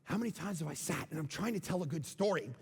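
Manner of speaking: ordinary delivery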